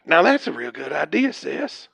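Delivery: low, soft approval of a scheme; conspiratorial undertone. Acted. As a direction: agree on the sly, keeping it down. Plotting quietly with the sibling.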